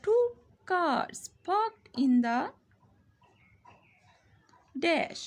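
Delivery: very slow, word by word; slow classroom reading of the instruction; slow dictation reading. Shown as a demonstration. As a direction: read the instruction aloud slowly. Reading the sentence slowly.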